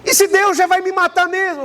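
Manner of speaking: woman insisting the prophetess is anointed; voicing a believing woman. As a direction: gush about how holy she is.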